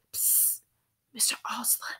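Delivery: breathy hushed call; whisper. A narrator's reading. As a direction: whisper his name urgently. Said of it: "she whispered as she hurried by".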